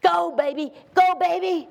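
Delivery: put-on loud high cheer of go baby; mock mom cheer; mama cheering. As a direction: cheer a child on from the stands. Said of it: "my mama was".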